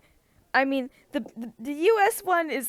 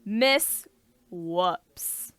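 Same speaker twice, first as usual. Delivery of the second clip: mimicked cartoon delivery, dropping on the second word; Miss Whoops voice; 4 semitones lower than usual. Host repeating how Miss Whoops talks, halting character voice. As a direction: pause mid-name, then drop lower. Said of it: "It is how she talks".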